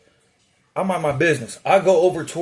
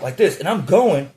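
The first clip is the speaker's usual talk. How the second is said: ordinary delivery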